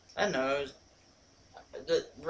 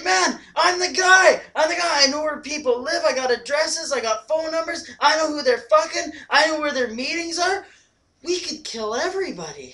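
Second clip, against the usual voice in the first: mock enlightened Rocco; 10 semitones higher, 7 dB louder than usual. Friend retelling favorite movie line, Rocco's awed boast. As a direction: say it like a revelation. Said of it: "he says it like he's just enlightened".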